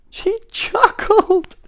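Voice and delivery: extremely high, loud drawn-out wail; hammed-up shrieking sob; crying king. A narrator's reading. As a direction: squeal it like a weeping king. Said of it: "cried the king".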